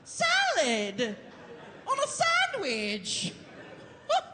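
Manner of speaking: high incredulous squeak; mock disbelief. Teasing a crowd member in mock disbelief. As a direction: act scandalized at the answer.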